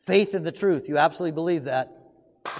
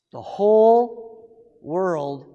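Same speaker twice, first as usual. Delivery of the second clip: "The whole world" very slow and loud, stretching "whole"; slow, booming "The whole world"; drawn-out emphasis; 5 dB louder, much slower than usual. Acted. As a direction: draw out "whole" for weight. Preacher stretches key phrase.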